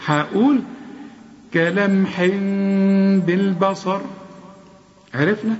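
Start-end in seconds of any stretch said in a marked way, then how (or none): 1.5-4.0 كلمح بالبصر with the m hidden in a nasal hum, lips barely closing. Shown as a demonstration. Teacher showing the hidden m before the b. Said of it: "مش هقفل شفايفي".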